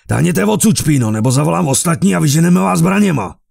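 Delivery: full-throated furious shout; roared. A narrator's reading. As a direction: roar at the beggars to leave. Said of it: "Zařval".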